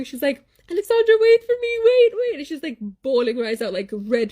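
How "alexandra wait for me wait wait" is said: put-on high, loud and fast, a scared little girl screaming after her cousin; a child stuck in a pothole screaming for her cousin to wait, high and loud; panicked scream. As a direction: shriek it as if left behind in terror. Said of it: "like, s-, screaming".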